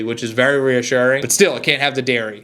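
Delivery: ordinary delivery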